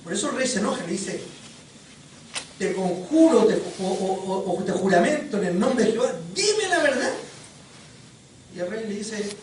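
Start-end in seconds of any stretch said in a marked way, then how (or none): 2.6-7.1 angry king. Retold or imitated. Angry king demands the truth.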